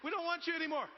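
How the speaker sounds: high, loud kicking-out act; imitated outraged trainer; mock angry dismissal. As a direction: throw them out, hammed up. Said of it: "Literally kicked out of the class".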